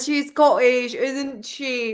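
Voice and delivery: normal talking voice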